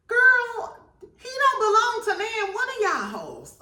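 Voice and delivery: high scandalized shriek; high exclamation. Real, not acted. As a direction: call them all out, scandalized. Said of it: "exclaiming at the cast".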